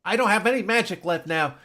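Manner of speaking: loud, high frustrated outburst; mock exasperated dad. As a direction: snap at Terry in frustration. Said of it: "he's like".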